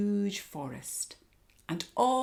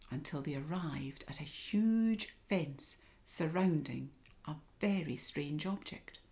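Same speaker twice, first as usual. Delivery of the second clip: ordinary delivery, nothing marked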